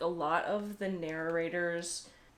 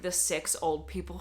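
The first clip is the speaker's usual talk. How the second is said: normal talking voice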